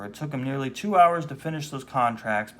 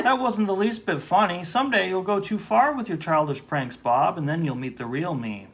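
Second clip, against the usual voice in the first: offended clerk; 6 semitones higher, 6 dB louder than usual. Acted. Casper angry at prank.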